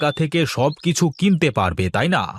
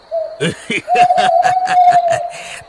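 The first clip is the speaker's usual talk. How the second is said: evil cackle; 5 dB louder than usual. Acted. Dacoit mocks the village head.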